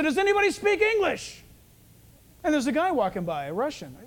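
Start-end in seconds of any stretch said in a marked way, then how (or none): 0.0-1.4 high-pitched call to passers-by. Retold or imitated. Preacher retelling his street appeal for a translator, calling out. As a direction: call out a question to anyone in earshot.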